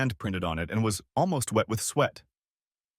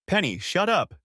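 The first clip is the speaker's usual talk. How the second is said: higher, mild snap of a mother; sharp mother; 9 semitones higher, 3 dB louder than usual. A narrator's reading. Mother telling daughter to hush, mild snap. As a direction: cut her daughter off. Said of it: "Judy said".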